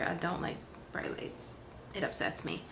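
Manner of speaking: unvoiced, soft confession; whispered aside. Real, not acted. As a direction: whisper it as a secret. Aside about lights.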